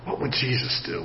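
hushed, half to himself; a private thought, almost swallowed; quiet inner thought. Retold or imitated. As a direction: muse it under your breath.

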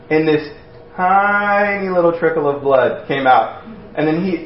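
ordinary talk, nothing marked

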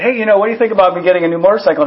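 rattled off fast, trying to slip it by; quick offhand question, said fast; quickly tossed-off question; rushed casual ask. Retold or imitated. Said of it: "And one time I said".

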